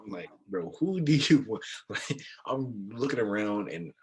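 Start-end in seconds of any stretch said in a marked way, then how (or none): 0.5-1.6 high, loud exasperated mock yell; insistent imitation of yelling; retold yelling. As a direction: holler it at your friend, retelling the moment. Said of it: "people see me yelling".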